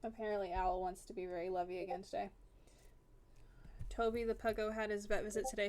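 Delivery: usual speaking voice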